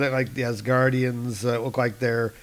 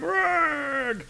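high mock war roar; angry roar. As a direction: roar it like an old warrior. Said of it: "he's going".

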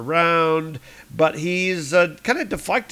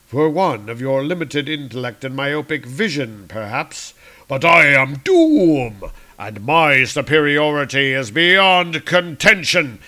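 Doctor Doom; 6 dB louder than usual. Acted. Doom answering the guards, arrogant. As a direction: declare supremacy. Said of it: "Doom replies".

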